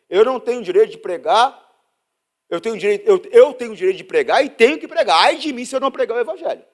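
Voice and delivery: loud, high preaching climb; impassioned pulpit cry; fiery preaching. Acted. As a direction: preach it with fire. Preaching at full force.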